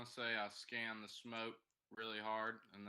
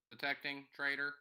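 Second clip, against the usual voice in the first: robot voice; 5 semitones higher, 7 dB louder than usual. Acted. Robot character accuses a traitor.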